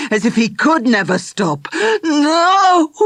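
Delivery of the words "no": high loud yell. Acted. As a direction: yell it to stop him. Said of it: "Charlie yelled".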